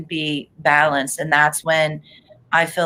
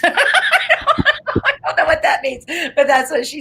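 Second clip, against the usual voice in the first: high, laughing bafflement; 9 semitones higher, 3 dB louder, faster than usual. Real, not acted. Psychic puzzled by the spirit's odd phrase, laughing. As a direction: talk through a fit of giggles.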